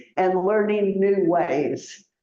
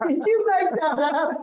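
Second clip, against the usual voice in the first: amused; 8 semitones higher, much faster than usual. Real, not acted. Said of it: "amused teasing question".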